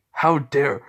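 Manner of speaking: a father's furious shout; outraged shout. Retold or imitated. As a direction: bark it out, outraged. Angry father shouts at the attacker.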